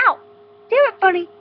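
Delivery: sudden pained shout; angry yell. Real, not acted. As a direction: yell out after a bite. Bitten by the bunny.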